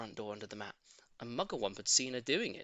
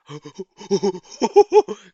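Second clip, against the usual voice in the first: high, loud gleeful cackle; excited cackle; 7 semitones higher, 17 dB louder than usual. A narrator's reading. Mugglewump delighted the ceiling is done, gleeful cackle. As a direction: cackle with mad glee. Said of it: "cried Mugglewump".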